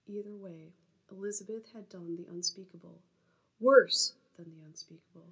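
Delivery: measured novel reading; story narration. Acted. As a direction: read the excerpt to listeners. Reads her novel excerpt aloud.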